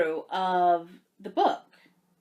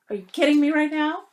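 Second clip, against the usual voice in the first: louder, higher thrilled disbelief; excited disbelief; 5 semitones higher, 5 dB louder, faster than usual. Real, not acted. Vlogger showing a pattern to viewers, thrilled disbelief. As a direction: gush over the pattern.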